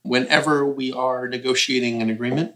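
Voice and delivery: ordinary talk, nothing marked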